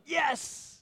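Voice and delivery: a high, bursting yes of pride; triumphant yes. Retold or imitated. Pastor recalling mowing, cheering his perfect rows. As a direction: cheer the finished lawn. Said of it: "I'll be like".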